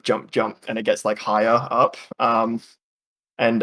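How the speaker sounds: ordinary talk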